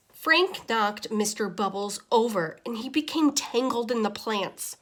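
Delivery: ordinary delivery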